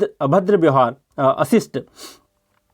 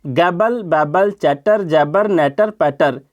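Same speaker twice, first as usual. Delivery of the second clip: slow, deliberate listing of the words; slow read-aloud; much slower than usual. Shown as a demonstration. Teacher reads the word list slowly for students. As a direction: read each word slowly so they hear the rhythm. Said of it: "सुन रहे हो ध्यान से?".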